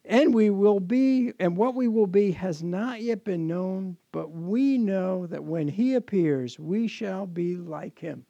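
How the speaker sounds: slow, weighty reading of scripture; sermon scripture reading. Acted. Pastor reading First John aloud to the congregation, slow and weighty. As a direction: let each promise land slowly.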